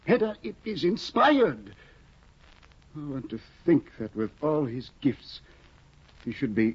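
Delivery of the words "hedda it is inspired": high, slow and marvelling; gushing over a work of genius; awed excitement. Acted. Tesman marvels to Hedda at Eilert's manuscript, high and awed.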